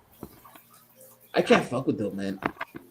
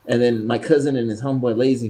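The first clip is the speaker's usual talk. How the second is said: ordinary talk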